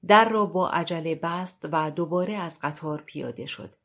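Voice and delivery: ordinary delivery, nothing marked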